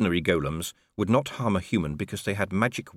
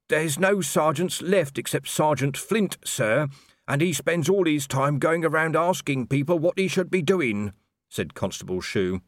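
higher, polite junior officer voice; dutiful young constable; 7 semitones higher than usual. A narrator's reading. Constable Shu politely asking Colon for the wages chitty. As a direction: report earnestly to your captain.

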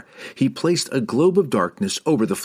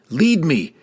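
high, raised call to a companion; urgent cry; 7 semitones higher, 4 dB louder than usual. A narrator's reading. Drizzt calling across the chamber to Wulfgar, crying out. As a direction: cry out the command, pitched up. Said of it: "he cried".

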